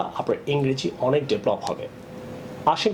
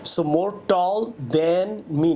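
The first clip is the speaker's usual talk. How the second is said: very slow demo; 3 semitones higher, much slower than usual. Shown as a demonstration. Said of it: "না".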